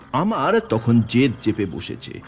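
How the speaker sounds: plain reading voice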